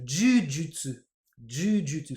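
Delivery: JUJUTSU with a long first vowel, "juu-jutsu"; Japanese jujutsu. Shown as a demonstration. Teacher naming the Japanese sport, JUJUTSU with long first vowel. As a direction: hold the first syllable of JUJUTSU. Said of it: "En japonais, il y a un sport".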